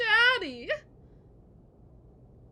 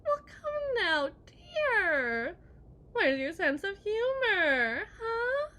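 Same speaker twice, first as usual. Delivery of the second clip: higher, louder, slow sing-song taunt; mock sweet teasing; 7 semitones higher, 9 dB louder, slower than usual. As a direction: coo it sweetly while mocking him. Wife teasing, teary laughter.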